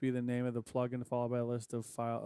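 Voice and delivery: ordinary talk, nothing marked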